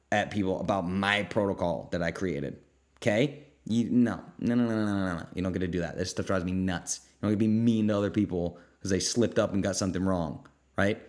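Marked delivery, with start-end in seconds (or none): none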